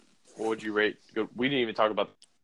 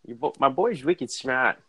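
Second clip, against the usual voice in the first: slow put-on Boston accent, wicked smart; 3 semitones higher, 3 dB louder, slower than usual. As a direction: fake a Boston accent slowly, dropped R in smart. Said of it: "fake a Boston accent".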